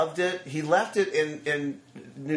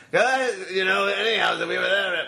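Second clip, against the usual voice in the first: imitating slurred, raised-voice rambling; loud, high mock babble from a wasted man; coked-out calling over shoulder; 5 semitones higher, 3 dB louder than usual. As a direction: call out garbled words as if drugged. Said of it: "is calling over his shoulder".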